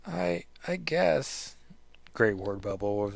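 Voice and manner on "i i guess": slow, hesitant shaky voice. Acted. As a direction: say it wavering and unsure. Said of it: "the shakiness of it".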